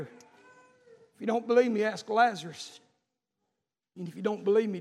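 ordinary delivery

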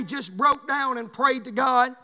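ordinary delivery

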